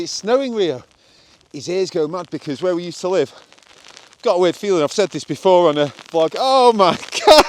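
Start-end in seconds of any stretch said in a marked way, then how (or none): 0.0-0.8 high, bright-eyed call to the dog; excited. Shown as a demonstration. Owner teasing the dog with exciting news, high and excited. 6.4-7.4 high, loud laughing outcry, slowed; real disbelief breaking into laughter; laughing shock. Real, not acted. Vlogger caught in sudden hail, laughing out loud. Hailstorm hits mid vlog.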